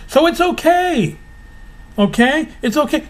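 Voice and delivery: sarcastic high-pitched comforting; sarcastic reassurance. Acted. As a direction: pat them on the head, sarcastically.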